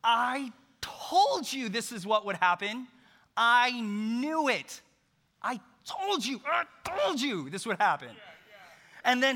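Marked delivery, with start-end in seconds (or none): 0.0-8.1 higher, louder whiny told-you-so; hammed-up petulant I-told-you-so; mock Jonah complaining. Jonah griping at God, loud whiny mock complaint. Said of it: "He goes".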